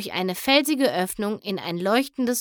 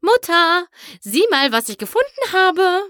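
much higher, louder excited call; calling out excitedly; 10 semitones higher, 6 dB louder than usual. A narrator's reading. Skystar calls to her mother on the throne, thrilled. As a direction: shout to mother across the throne room. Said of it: "Skystar rief".

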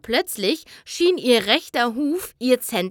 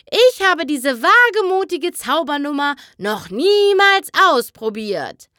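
higher, showy boast to the crowd; boastful showman; 5 semitones higher, 5 dB louder than usual. Acted. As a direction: sell the danger grandly. Magician hypes the danger. (prahlerische Showstimme)

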